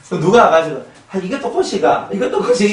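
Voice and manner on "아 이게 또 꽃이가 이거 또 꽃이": 높고 크게 또 꽃이냐고 투덜대; 시큰둥하게 꽃을 타박해; 못마땅한 투덜거림. Retold or imitated. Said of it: "이거 하고 있다는 말이에요". (꽃을 보고 높고 크게 타박하는 손님)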